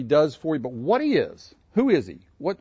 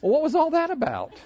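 higher, laughing, puzzled question; lightly voiced wife, amused; 13 semitones higher than usual. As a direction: play the baffled wife. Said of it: "my wife would come up".

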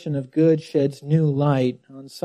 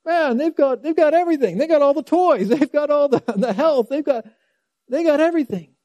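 high-pitched envious outburst, piling up complaints; mock envious gawping at others' riches, pitched way up; envious griping; 11 semitones higher, 4 dB louder, faster than usual. Psalmist looking out at those who have everything, envious. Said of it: "he's looking out like".

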